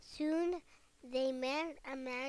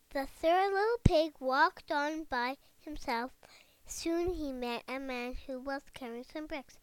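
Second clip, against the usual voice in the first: ordinary delivery, nothing marked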